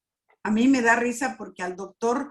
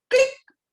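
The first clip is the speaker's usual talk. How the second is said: sharp click sound; 7 dB louder than usual. Retold or imitated. God clicks a thought in.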